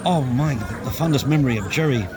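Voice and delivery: ordinary talk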